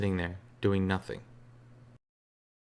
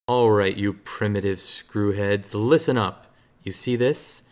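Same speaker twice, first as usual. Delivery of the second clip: slow, loud hammed-up movie hero swagger; Evil Dead's Ash showing off his shotgun, cocky and loud; Ash quote reading; 4 dB louder, slower than usual. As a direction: ham up the boomstick speech like a B-movie hero. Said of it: "Hellbreaker wants me to read... what accent this should be".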